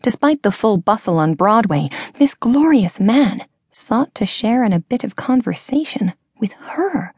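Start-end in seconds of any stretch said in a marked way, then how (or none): none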